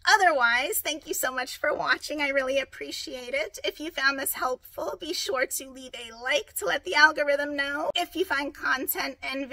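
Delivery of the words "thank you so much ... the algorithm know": usual speaking voice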